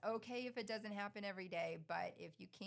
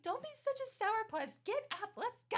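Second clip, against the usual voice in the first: much higher and louder sing-song coaxing; peppy teasing; 14 semitones higher, 7 dB louder than usual. Retold or imitated. Mom coaxing grumpy son awake, high peppy teasing. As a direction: cheerlead him out of bed.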